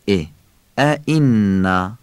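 usual speaking voice

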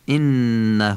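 انه with a humming nn and long "huu"; stretched ending. Shown as a demonstration. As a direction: hold the nasal n, then draw out the end of انه. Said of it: "حتماً کشیده بخونید".

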